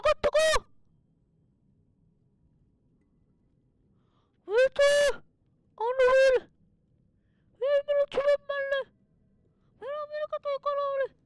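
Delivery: urgent cries as the fish appears; excited high yelling. Real, not acted. Gamer shouting to chat about a big fish, excited. Excited yells at deep sea.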